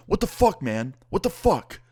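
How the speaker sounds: high-pitched mock outrage; angry neighbor. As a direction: act out an irate neighbor's outburst. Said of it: "ten times more angry than the first time".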